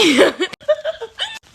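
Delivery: high teary laugh after a burp; helpless laughter tipping into tears; laugh-crying. Real, not acted. Laughing at her own burp until tears come. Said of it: "I don't know why I'm crying".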